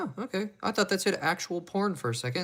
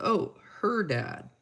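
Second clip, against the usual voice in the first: slow, higher, lightly voiced parent; a customer's parent gently correcting the clerks, slow and raised; parent's correction; 6 semitones higher, much slower than usual. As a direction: draw out a mild correcting parent. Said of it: "the parent hit us with the".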